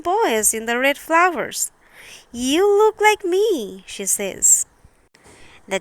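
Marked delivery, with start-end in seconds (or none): 2.3-3.8 higher, slow, lightly voiced amazed girl; a touch of a wondering small girl; Thumbelina's voice. Thumbelina meeting tiny girls and boys, in a light wondering voice.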